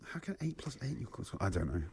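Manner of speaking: very soft, puzzled mumble; barely voiced pondering, dropping low; muttering to himself. Real, not acted. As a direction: think the riddle through under your breath. Puzzling over the riddle to himself.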